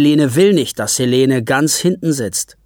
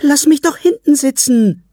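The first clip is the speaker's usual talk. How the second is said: put-on much higher, louder pleading girl; begging child; 13 semitones higher, 6 dB louder than usual. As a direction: plead desperately to sit at the back. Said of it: "bettelt Helene".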